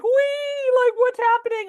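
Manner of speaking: mock carefree thrill ride squeal; giddy wheee. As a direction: sister riding the falling tree, squealing with glee. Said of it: "I'm like".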